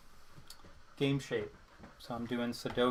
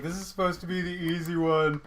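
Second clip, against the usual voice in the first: mock complaint; 2 semitones higher, 5 dB louder than usual. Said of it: "And I'm like".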